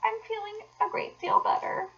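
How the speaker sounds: very high, softened feeble falsetto; weak, high invalid voice. A narrator's reading. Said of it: "said Toad in a feeble voice".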